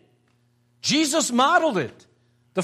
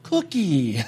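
put-on little child's voice; much slower than usual. Grandfather mimicking his grandson eyeing a cookie, little child's voice. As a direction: imitate a little boy cooing over a treat. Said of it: "hear that little voice go".

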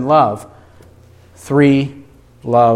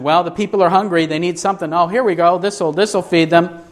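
quick, higher-pitched casual patter; offhand mock reasoning; 4 semitones higher, faster than usual. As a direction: toss it off like a casual improvised fix. Voices imagined offhand reasoning about crowd.

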